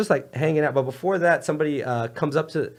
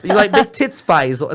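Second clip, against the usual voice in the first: mock Cockney, higher and louder; cockney come-on; 4 semitones higher, 6 dB louder than usual. Retelling the wife's line to the host, lightly Cockney. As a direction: lean into the Cockney flirt. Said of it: "the more cockney she gets".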